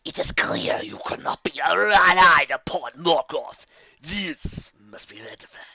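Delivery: slow, rasping whisper, disappointed; a looming shadow letting out its disappointment; disappointed rasping entity; sighing dark voice. A narrator's reading. Dark master pronounces judgment.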